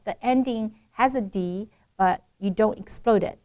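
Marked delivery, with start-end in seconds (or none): none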